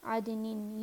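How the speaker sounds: عدنن said adnin; "in" ending. Shown as a demonstration. Teacher listing three forms, عدنن ending in "in". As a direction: end عدنن in "nin". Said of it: "یہ تین طرح سے".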